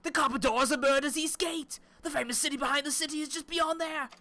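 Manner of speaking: theatrical British voice, high and loud; British accent narration. Pretend. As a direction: announce the city like a pompous British guide. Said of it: "Why would you want me to do a British accent?".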